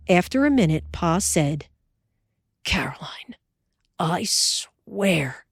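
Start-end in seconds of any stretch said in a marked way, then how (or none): 2.6-5.5 husband whispering, softer; whispered. Acted. Pa whispering in the night.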